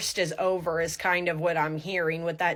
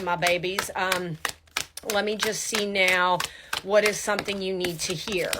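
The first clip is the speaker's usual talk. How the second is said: normal talking voice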